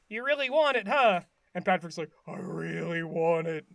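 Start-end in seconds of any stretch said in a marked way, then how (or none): 0.1-1.2 higher, swooping placating question; SpongeBob. Retold or imitated. SpongeBob humouring Patrick about the shirt, higher lilting voice. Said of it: "SpongeBob is like". 2.3-3.7 low monotone, eerily intent; creepy Patrick. Retold or imitated. Patrick at the window wanting the shirt, creepy low voice. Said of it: "Patrick's so creepy".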